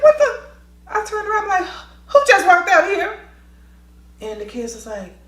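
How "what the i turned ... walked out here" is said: loud, high startled cry; loud surprised what the; startled outburst. Retold or imitated. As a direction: react to the slam in shock. Startled by door slam.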